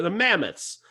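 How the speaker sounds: ordinary talk, nothing marked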